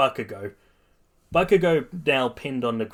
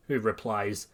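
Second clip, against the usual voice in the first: ordinary delivery, nothing marked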